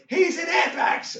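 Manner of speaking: high, slow, loud holler; imitated excited heckle; kids in audience. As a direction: shout it out from the crowd. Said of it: "two kids from the audience says".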